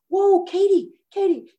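high, loud calling after someone; urgent, high-pitched mock alarm; alarmed doctor. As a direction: imitate a startled doctor halting a patient. Doctor startled by her storming out.

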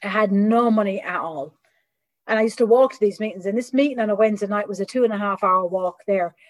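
ordinary talk, nothing marked

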